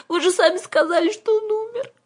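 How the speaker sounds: put-on very high, wailing little girl; squeaky, tearful objection; tearful child's protest. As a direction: protest as if crying.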